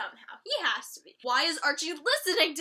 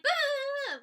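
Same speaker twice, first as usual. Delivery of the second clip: high mock bah; mock scoff; 11 semitones higher than usual. Vlogger mimicking Kevin brushing Betty off, scoffing. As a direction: scoff like a grump. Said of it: "he's like".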